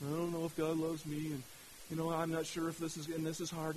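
low, soft, deflated mumbling of doubt; doubting, dejected. Acted. Faith sitting around doubting God's love, dejected. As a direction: voice a weary faith, low and quiet.

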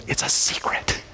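hushed, breathless "It's a secret"; conspiratorial whisper. Retold or imitated. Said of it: "He goes".

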